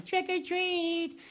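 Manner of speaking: put-on high, slow trick-or-treat kid voice; little kid Dracula. Little Dracula kid at the door, high and slow. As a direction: squeak it out like a tiny trick-or-treater.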